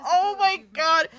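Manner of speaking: high, slow and laugh-cracked; gasping it out mid-laugh; real laughter. Real, not acted. Laughing reaction quote.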